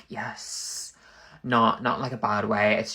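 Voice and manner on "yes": a soft breathy whisper; whispered yes. Retold or imitated. Molly answering Britain with a timid whispered yes. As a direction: barely whisper it, meek. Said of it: "she'd be like".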